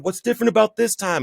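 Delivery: high, strained mock scream at God; desperate high-pitched retold plea. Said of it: "So I'm screaming and I'm yelling at God".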